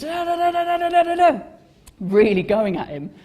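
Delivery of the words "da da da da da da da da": high, loud mock German tirade; hammed-up angry gibberish German; angry German rant. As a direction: rattle off fake German like a furious stranger. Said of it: "this guy came up to me in German".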